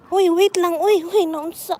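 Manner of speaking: sudden high startled call. Real, not acted. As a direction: react in surprise. Startled by the dog.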